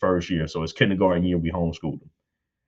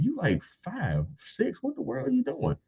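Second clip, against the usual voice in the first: high, slow, incredulous; can't believe it; baffled disbelief; 6 dB quieter than usual. Retold or imitated. A father baffled at his five-year-old hyperventilating.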